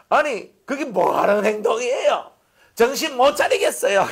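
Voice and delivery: acted angry scolding. Pastor demonstrating how he would scold his flock, mock furious. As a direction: tell them off in exaggerated outrage. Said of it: "제가 막 이렇게 한다면".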